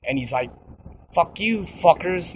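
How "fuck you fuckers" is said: higher, half-yelled insult thrown back; a touch of hammed-up anger; mock angry retort. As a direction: snap back at him in a retold fight. Retold quarrel with the dude.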